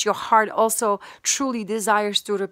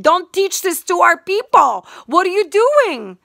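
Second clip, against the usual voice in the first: forceful rebuke; 7 semitones higher, 4 dB louder than usual. Real, not acted. Preaching rebuke.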